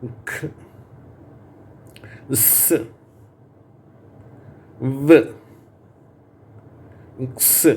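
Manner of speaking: bare consonant sounds, short and isolated, pausing for the class; each sound clipped off alone for students to echo; repeat-after-me sounds. Shown as a demonstration. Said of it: "நான் சொல்றேன், நீங்க திருப்பி சொல்லுங்க".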